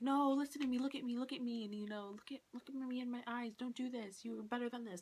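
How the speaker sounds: high, softened mock plea; retold begging, hushed after the first "No"; imitated pleading. Voices Eden pleading with Tyler.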